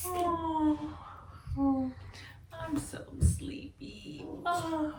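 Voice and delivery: high breathy sleepy sighs; sleepy act. Shown as a demonstration. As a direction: play at being very sleepy. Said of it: "Can you make a sleepy face?".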